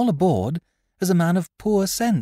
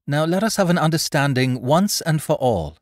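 raised, higher voice of calm authority; cool command; 10 semitones higher, 6 dB louder than usual. A narrator's reading. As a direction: lay down terms coolly and firmly. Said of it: "Polly Langton spoke up coolly, quiet command in her voice".

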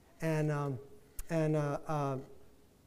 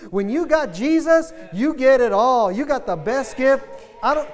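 loud, higher, fervent preaching; full-force preaching; 4 semitones higher, 5 dB louder than usual. Acted. Preacher rousing congregation about seeing loved ones, loud fervent. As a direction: preach it hard to the crowd.

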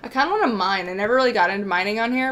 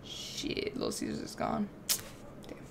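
whisper; 14 dB quieter than usual. Real, not acted. Muttered aside off stream.

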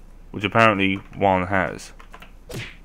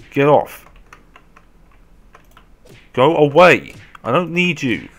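loud, raised-pitch yell; shouting off attackers; 4 semitones higher, 5 dB louder than usual. Real, not acted. Player ordering a zombie to go away, shouted. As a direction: shoo them away at full voice.

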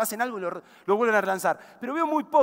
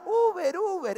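calling the driver, voice pitched up to carry; 7 semitones higher, 4 dB quieter, slower than usual. Retold or imitated. French couple hailing their Uber driver, calling out. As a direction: call out Uber, Uber to the passing car, raised high. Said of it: "le empiezan a gritar".

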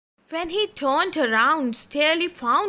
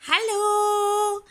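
high little-fish voice calling to friends; hopeful little fish calling friends; Sterling calling out; 8 semitones higher, much slower than usual. Acted. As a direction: call out brightly as a small fish. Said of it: "he called to his friends".

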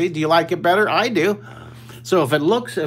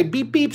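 mock bus horn beeps; 9 semitones higher than usual. Shown as a demonstration. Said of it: "Someone say".

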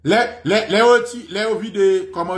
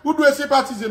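high-pitched pleading outcry; hammed-up reproach in a much higher voice; mock reproachful protester; 8 semitones higher than usual. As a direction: imitate an indignant acquaintance pleading. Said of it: "Mesye di".

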